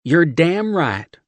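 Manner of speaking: slow, high, loud Southern agreement; smiling Southern. A narrator's reading. Steph agreeing with John, Southern accent. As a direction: smile it out. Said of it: "she smiled".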